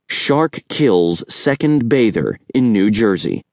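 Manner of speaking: slow, weighty headline; headline read. A narrator's reading. Reading the front page aloud, slow and weighty. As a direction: read it out like a banner.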